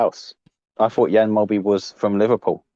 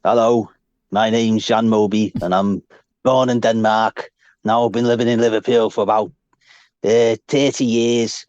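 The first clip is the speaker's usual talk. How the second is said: mega Scouse. Retold or imitated. Podcast host imitating a Danish-born ex-player, mega Scouse. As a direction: impersonate Jan Molby with exaggerated Scouse. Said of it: "Like, mega scouse. He's more scouse than scousers now".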